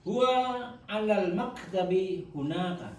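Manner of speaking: slow, even Arabic reading; slow model reading. Shown as a demonstration. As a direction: read it slowly for repetition. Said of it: "Saya baca jenengan anu ya". (bacaan contoh lambat)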